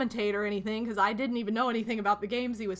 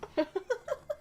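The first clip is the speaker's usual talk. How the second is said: high breathy giggle; 12 semitones higher, 5 dB quieter than usual. Real, not acted. Laughing at her own panic story, high giggle. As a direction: let a short high laugh out.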